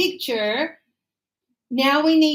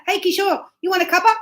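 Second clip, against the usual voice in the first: chummy, higher and louder offer to Kishore; casual to a friend; 5 semitones higher, 4 dB louder, much faster than usual. Retold or imitated. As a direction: toss it off casually to an old mate. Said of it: "'Cause we know each other".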